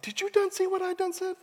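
mock amazement in a squeaky hush; comic high-pitched disbelief; awestruck sailor.

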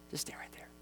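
even fainter whisper, nearly gone; fading whisper. Pretend. Peter repeating himself to the crowd, whisper fading out. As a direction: let the whisper die away to almost nothing.

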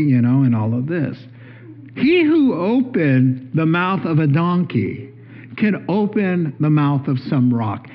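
ordinary talk, nothing marked

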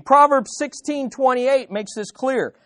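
usual speaking voice

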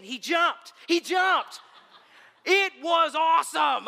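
loud, high, thrilled outburst; excited shout. Real, not acted. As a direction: shout it out in amazement. Dog leaps off the porch.

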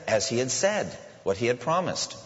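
weight on "said" and "promised", each landing with a firm fall; stressed repetition. Shown as a demonstration. Preacher to congregation, stressing "said" and "promised" firmly. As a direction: lean on "said" and "promised" to show the emphasis. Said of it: "Do you not see the emphasis there?".

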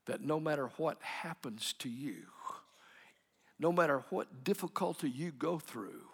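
breathy whispered promise. Acted. Preacher whispering assurance to listeners, hushed. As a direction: drop to a whisper to draw them in. Sermon climax, whispered phrase.

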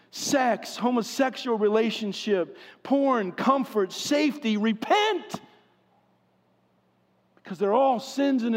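rising, fervent sermon delivery; preaching. Acted. Pastor urging his congregation to repent, preaching. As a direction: preach it with urgency.

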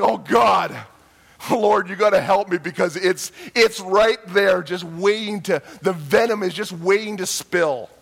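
loud, strained plea for help; desperate prayer. Retold or imitated. Preacher praying loudly for restraint during the neighbor's outburst. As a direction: cry out to God to hold back the anger. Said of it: "I was just like".

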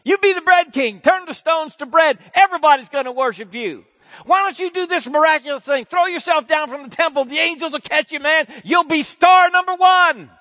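high, loud, slick tempter voice building to a shout; tempting devil. Acted. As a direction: sell the temptation like a hustler. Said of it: "the devil came to him".

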